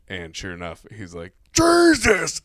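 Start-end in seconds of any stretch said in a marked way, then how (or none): 1.5-2.4 high loud startled shout; startled exclamation. Retold or imitated. Pilot dad shouting as another helicopter nearly hits them, startled yell.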